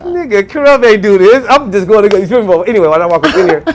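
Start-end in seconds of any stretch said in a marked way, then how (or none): 0.0-1.4 playful disbelief; mock outrage. Reacting to [name]'s kindness, high and loud.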